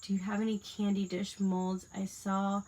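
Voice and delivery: ordinary talk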